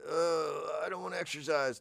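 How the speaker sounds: high, soft mock whine; reluctant client. Client resisting the fitness trainer, mock whiny protest. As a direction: whine it like someone pulled out of bed.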